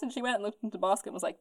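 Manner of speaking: ordinary talk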